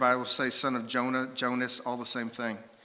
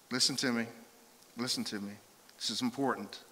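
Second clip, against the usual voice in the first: hushed, lower, grave insistence; intimate, earnest; 3 semitones lower, 6 dB quieter, faster than usual. Acted. Jesus to Peter, asking his attention, hushed earnestness. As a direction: draw them close and say it softly. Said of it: "it's an intimate moment".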